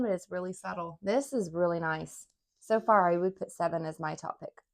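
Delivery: ordinary delivery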